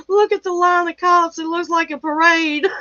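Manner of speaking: high, laughing through the words; can barely get it out for laughing. Real, not acted. Showing viewers the cop line, laughing hard.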